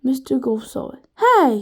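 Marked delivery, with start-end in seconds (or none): 1.2-1.6 sharp loud cry of alarm; shout. A narrator's reading. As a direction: yell to stop the plane. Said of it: "he shouted".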